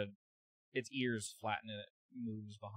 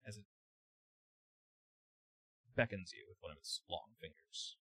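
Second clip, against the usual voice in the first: usual speaking voice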